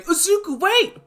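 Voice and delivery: high urgent cry after him; calling out. Acted. Uraraka calling Izuku back, high and loud. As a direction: shout his name to stop him.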